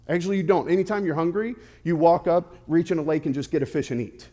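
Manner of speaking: usual speaking voice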